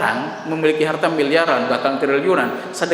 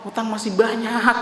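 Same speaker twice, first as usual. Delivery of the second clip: tearful prayer; 5 semitones higher, 5 dB quieter than usual. Retold or imitated.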